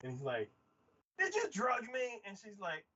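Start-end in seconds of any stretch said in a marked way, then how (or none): 1.2-2.2 high-pitched incredulous question; mock shocked accusation. Brother looks at his Coke, mock shocked accusation. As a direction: imitate an alarmed accusation.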